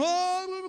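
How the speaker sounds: high mock-ecstatic "oh"; hammed-up, high-pitched trance cry; mock prophetic outburst. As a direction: imitate a false prophet's fervent babble. Mimics false prophets working themselves up.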